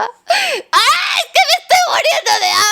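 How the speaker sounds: high, crying whine; hammed-up desperate hunger; whining as if dying of hunger; mock starving wail. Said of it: "yo por dentro estaba".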